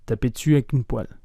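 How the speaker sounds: « taper dessus avec une poêle » dit vite, voyelles avalées; enchaînez « taper dessus avec une poêle » rapidement en perdant des voyelles; spoken fast. Shown as a demonstration. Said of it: "prononcée un peu plus vite". (« taper dessus avec une poêle » très rapide et condensé)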